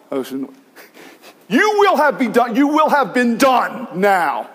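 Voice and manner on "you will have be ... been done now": high, loud, slow command to the sea; commanding shout. Retold or imitated. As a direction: thunder the order at the waves. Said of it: "That's what he says to the".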